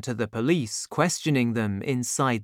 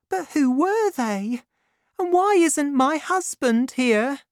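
put-on high, light grateful young woman; 13 semitones higher, 3 dB louder than usual. A narrator's reading. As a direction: lift the voice for the young heroine.

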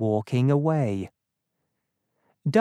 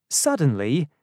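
ordinary delivery, nothing marked

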